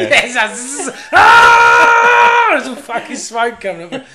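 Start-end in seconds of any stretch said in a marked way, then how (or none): 0.5-2.5 zap, then shriek, hammed up; mock electrocution. Fitter still stuck on the fence, comic buzz and scream. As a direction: buzz into a high, loud scream. Imitates shock and scream again.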